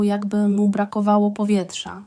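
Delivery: ordinary delivery, nothing marked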